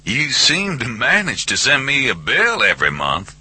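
high, joking Southern caller; man-to-man ribbing through a speakerphone; jocular over speakerphone. A narrator's reading. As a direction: tease him down a tinny phone line. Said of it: "jocular stuff".

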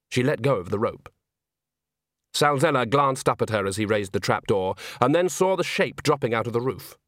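ordinary delivery

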